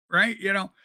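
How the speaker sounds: ordinary talk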